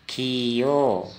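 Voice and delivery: खियो drawn out slowly, kh clear; slow model. Shown as a demonstration. Teacher answers his quiz with a slow, careful model. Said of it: "क्या उच्चारण करेंगे?".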